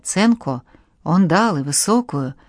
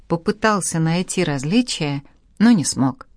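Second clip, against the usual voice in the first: ordinary delivery, nothing marked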